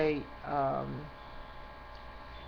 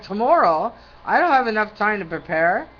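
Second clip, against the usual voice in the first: louder, rising "Tomorrow?" in mock shock; retold disbelief; 3 semitones higher, 9 dB louder than usual. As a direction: act out her stunned protest. Retelling her shocked reply.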